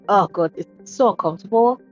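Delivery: ordinary talk, nothing marked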